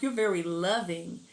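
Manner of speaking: ordinary delivery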